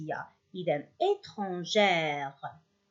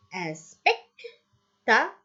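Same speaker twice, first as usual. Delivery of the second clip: "spectateur" as spec, ta, teur with gaps; slow "spectateur", a pause after each syllable; split into syllables; slower than usual. Shown as a demonstration. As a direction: break "spectateur" up slowly for pupils. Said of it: "teur, trois".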